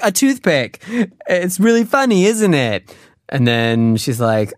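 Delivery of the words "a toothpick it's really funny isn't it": high-pitched mock delight at own joke; pleased punchline. Guy selling his lame joke to the girl, hammed-up delight. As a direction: play the joker fishing for a laugh.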